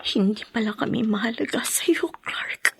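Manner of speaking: whispered through tears, hurt; a broken whisper of a wife who feels unwanted; crying whisper. Acted. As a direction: breathe the words out, weeping. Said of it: "Eh, bakit ka umiiyak?".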